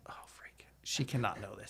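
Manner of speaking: hushed whisper so she can't hear; breathless aside, barely voiced; secret whisper. Real, not acted. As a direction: whisper it under your breath, keeping a secret. Hiding the news from panicking wife.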